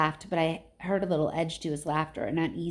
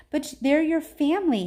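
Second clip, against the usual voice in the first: protesting; 9 semitones higher, 6 dB louder than usual. A narrator's reading. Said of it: "Annabeth protested".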